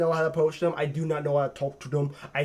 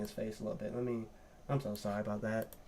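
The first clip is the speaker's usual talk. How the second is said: quiet; 7 semitones lower, 15 dB quieter, faster than usual. Real, not acted. Apologising for covering his face.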